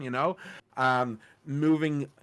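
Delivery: usual speaking voice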